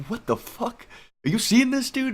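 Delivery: high, startled cry of disbelief; shocked disbelief. Retold or imitated. Tripping guy to his buddy, shocked high disbelief. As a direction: squeal it like you can't believe your eyes. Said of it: "I was like".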